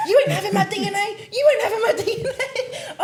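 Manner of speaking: high, loud mock yell through laughter; fake-furious shout, cracking up; squealed laughing rant; laughing mock fury. Angrily cussing caller, laughing.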